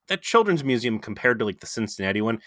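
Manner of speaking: ordinary delivery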